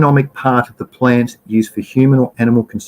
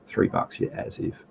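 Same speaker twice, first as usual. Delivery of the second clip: whispered, scoffing under the breath; a disbelieving whisper to himself; muttered aside; 3 semitones lower, 13 dB quieter than usual. Real, not acted. Scoffing aside about price.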